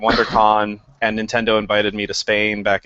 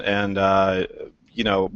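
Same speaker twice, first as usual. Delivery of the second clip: ordinary talk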